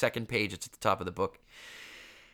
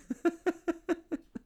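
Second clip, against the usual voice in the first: real laugh; high laugh; 3 dB quieter than usual. Real, not acted. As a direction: burst of high laughter at himself. Laughing at own story.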